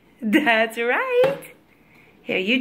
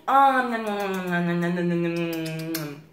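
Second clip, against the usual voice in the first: nom nom; 3 semitones lower, 4 dB quieter than usual. Pretend. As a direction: pretend munching noises. Mom pretends to eat offered food.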